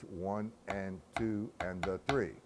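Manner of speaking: slow, evenly measured count; steady rhythmic counting; counting the figure. Shown as a demonstration. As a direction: count each beat out in strict time. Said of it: "with the figure".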